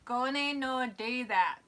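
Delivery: slow mock Scottish accent. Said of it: "because it's a Scottish comedy".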